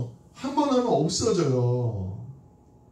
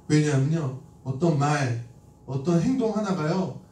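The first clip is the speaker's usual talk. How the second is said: ordinary talk, nothing marked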